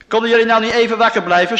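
loud, fast, high shout. A narrator's reading. Preacher reading Jesus shouting at disciples, loud and fast. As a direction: yell at the sleeping disciples. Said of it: "schreeuwde Jezus".

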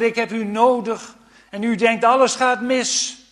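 loud, impassioned preaching; fervent preaching. Acted. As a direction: preach it out with full force. Preaching at full force. (vurig en luid preken)

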